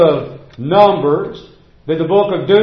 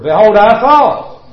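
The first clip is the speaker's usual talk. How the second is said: louder, slow indignant Naaman quote; quoting Naaman indignantly; 4 semitones higher, 6 dB louder, slower than usual. Retold or imitated. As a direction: proclaim Naaman's proud complaint, slowly. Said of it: "with Naaman, the leper".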